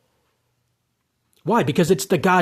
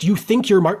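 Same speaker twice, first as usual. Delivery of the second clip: usual speaking voice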